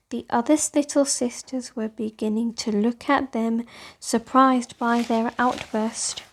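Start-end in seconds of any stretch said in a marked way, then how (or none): none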